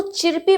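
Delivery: चिरपी with "ee"-like vowel and sounded R; mispronounced chirpy. Shown as a demonstration. Teacher warning learners against a misreading, चिरपी with hard R. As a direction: say the faulty चिरपी, R audible. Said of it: "इसको चिरपी मत पढ़िएगा".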